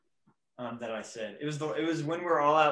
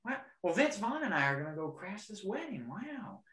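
Owen Wilson impression; 6 semitones higher, 6 dB quieter than usual. Cohost showing off his Owen Wilson on the podcast, high soft wows. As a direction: do a soft, amazed surfer-dude wow. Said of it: "anyone can do Owen".

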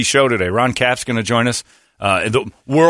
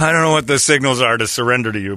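normal talking voice

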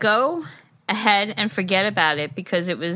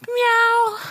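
cat meow; 11 semitones higher than usual. Pretend. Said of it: "I was just about to meow".

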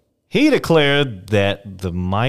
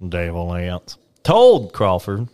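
ordinary talk

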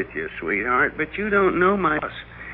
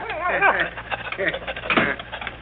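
laugh; 5 semitones higher, 4 dB quieter than usual. Acted. Laughs while dog jumps.

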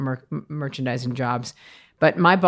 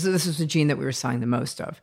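ordinary delivery

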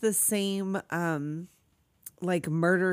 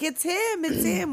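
high, pleading mock cry; mock terrified cry; 9 semitones higher than usual. Trapped woman crying out about the killer, mock terror.

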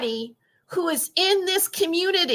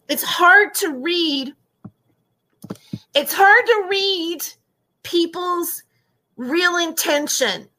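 crying over what the chat wrote; real crying; 3 semitones higher than usual. Real, not acted. Streamer to her chat, voice breaking into tears. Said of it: "I'm really sensitive right now".

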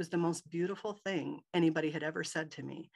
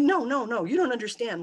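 higher and louder, pleading to be believed; urgent insistence; 7 semitones higher, 7 dB louder than usual. Retold or imitated. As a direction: insist hard that she listen. Insisting to the disbelieving nurse.

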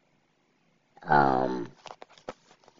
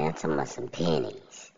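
usual speaking voice

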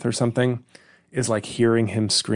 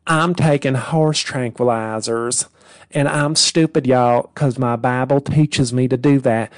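dumb Southern character parody; 3 semitones higher, 3 dB louder than usual. Retold or imitated. Comic mocks the generic Southern parody, a hammed-up Southern accent. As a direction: play a dim Southern churchgoer.

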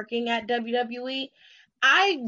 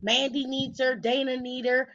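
ordinary talk, nothing marked

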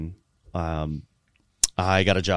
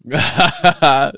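slow, loud, laughing through it; laughing while still stung by the smell; 9 semitones higher, 13 dB louder than usual. Real, not acted. Laughing after sniffing salts.

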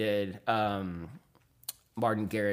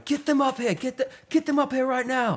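mock British accent, pitched much higher and a bit louder; lively British-accent impression with a raised voice; 4 dB louder than usual. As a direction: imitate an excited British DJ calling people up, high and loud. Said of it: "He's talking in his British accent".

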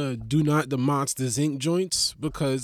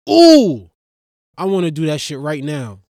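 excited squeal; 9 dB louder than usual. Retold or imitated. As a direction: squeal like a kid at a skatepark.

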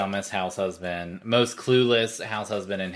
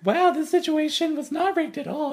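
mock innocent; 8 dB louder, faster than usual. Mocking Lisa's denial.

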